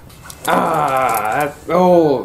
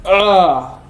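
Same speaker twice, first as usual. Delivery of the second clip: ordinary talk, nothing marked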